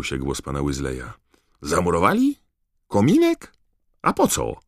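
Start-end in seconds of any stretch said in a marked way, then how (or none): 1.6-4.6 astonished, squeaking up high; puzzled disbelief; baffled surprise. Acted. Mr Weasley baffled behind the wall, astonished questions.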